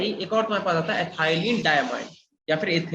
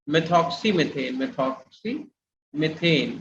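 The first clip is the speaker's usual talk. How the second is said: slow dictation; 3 semitones lower, much slower than usual. Shown as a demonstration. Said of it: "teacher dictating name slowly".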